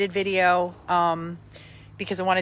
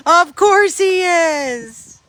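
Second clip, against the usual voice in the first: much higher, louder and slow, drawn out; exaggerated knowing; 8 semitones higher, 8 dB louder, much slower than usual. Real, not acted. To viewers about Tank at the groomers, hammed-up knowing. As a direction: stretch it like a knowing, exaggerated punchline.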